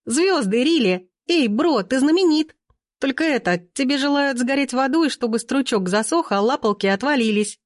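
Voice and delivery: put-on high, gleeful teen voice; excited teenagers. A narrator's reading. Teenagers to Timofey reading phone comments, gleefully high. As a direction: read it out pitched high, with relish.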